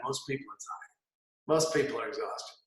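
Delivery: low, quiet exhausted voice; mock tired. Shown as a demonstration. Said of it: "sad tired people".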